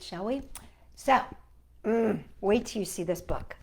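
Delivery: usual speaking voice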